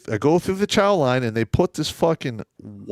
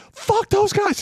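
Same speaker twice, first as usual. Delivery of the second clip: high-pitched indignant cry; retold angry outburst; slower than usual. First sergeant venting to the colonel, high angry outburst. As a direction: re-enact storming in still fuming, voice pitched way up.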